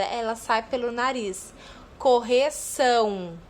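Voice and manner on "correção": "Correção" slow, louder, ending nasal "ão". Shown as a demonstration. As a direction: stretch "Correção" with a buzzing nasal "ão". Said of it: "dá uma vibraçãozinha aqui no nariz". ("ção" bem nasal, devagar)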